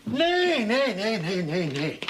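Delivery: whinny it out, nay after nay; horse whinny imitation. Farmer shows the vet how the horse sounds, neighing. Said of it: "does he sound like this".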